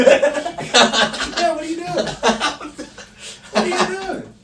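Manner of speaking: high laughing disbelief. Real, not acted. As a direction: laugh it out, loud and incredulous.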